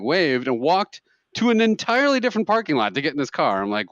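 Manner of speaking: ordinary talk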